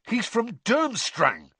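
high, slow, indignant stress; spitting anger. A narrator's reading. Ron accusing Hermione over her date, spat angrily. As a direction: spit it out as an accusation. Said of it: "spat Ron".

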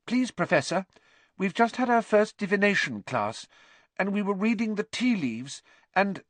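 high, eager pupil voice; very high, careful student explanation; earnest schoolgirl. Acted. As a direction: explain politely to the teacher.

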